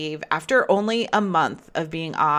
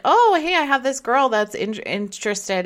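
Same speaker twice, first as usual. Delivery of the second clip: high, bright, chatty recommendation; a hint of a cheery acquaintance on the phone; imitating a caller; 8 semitones higher, 4 dB louder than usual.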